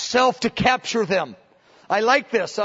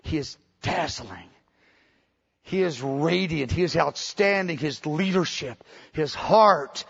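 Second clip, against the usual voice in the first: awed adoration; 4 semitones lower than usual. Retold or imitated. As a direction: breathe the praise in wonder. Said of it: "She turns around, she says".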